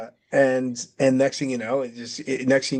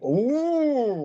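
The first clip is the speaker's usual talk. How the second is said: high drawn-out ooh; a much higher, stretched-out shocked ooh; high mock-shocked ooh; 12 semitones higher than usual. Reacting to the accusation.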